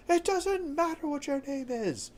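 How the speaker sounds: mock The Rock cutting someone off; Rock impression. Quoting The Rock's famous line.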